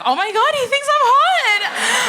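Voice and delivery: high, loud giddy squeal, slowing on "hot". Retold or imitated. Flattered comic squealing to the audience. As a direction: squeal like you're flattered despite yourself. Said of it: "And I was like".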